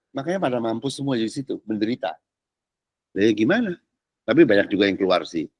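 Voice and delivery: normal talking voice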